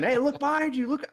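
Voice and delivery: high, loud mock yell; imitated yelling. Friends yelling at the scared VR player, mock high-pitched yell. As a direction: imitate friends yelling a warning from outside the game. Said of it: "hear my friends yelling".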